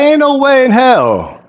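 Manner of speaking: mock outraged disbelief; disbelieving. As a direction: throw it up high like you can't believe it. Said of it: "I was like".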